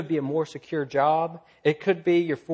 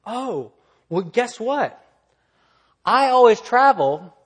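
higher voice, building louder on the boast; mock excited traveller; 7 semitones higher, 7 dB louder, slower than usual. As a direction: imitate an eager traveller chiming in. Traveller chiming in with glee.

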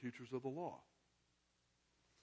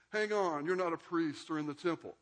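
mock objection; 4 semitones higher, 8 dB louder than usual. Imagined crowd objection, laughing lecturer.

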